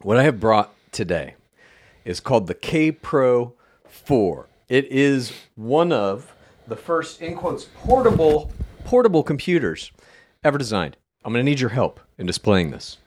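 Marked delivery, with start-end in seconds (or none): 0.0-11.0 slow presentation. Acted. Presenting like a show-and-tell.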